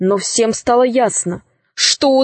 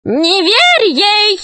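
high, drawn-out warning cry; screeching witch; 10 semitones higher, 3 dB louder, much slower than usual. A narrator's reading. Witch warns the dragon about Alisa, high cry. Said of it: "закричала ведьма".

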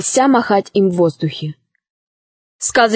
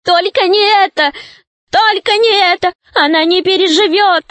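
high, pleading gnome wail; desperate cry; 9 semitones higher than usual. A narrator's reading. Gnome begs for his beloved doll's life, desperate high cry. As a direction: beg in a panicked high voice. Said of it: "закричал".

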